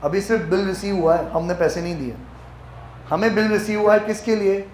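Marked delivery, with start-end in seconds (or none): none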